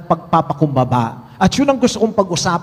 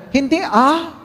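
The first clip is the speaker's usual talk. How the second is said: higher, lightly voiced mother; mock mother's quick denial; 8 semitones higher, slower than usual. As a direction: brush it off as the mom. Mother answers in joke.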